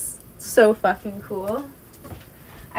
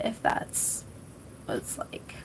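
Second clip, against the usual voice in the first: whispered trail-off; 12 semitones lower, 16 dB quieter than usual. Real, not acted. Trailing off, unsure.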